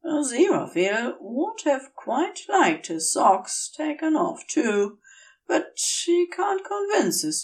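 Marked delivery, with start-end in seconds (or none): none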